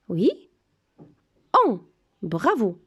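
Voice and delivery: ordinary talk, nothing marked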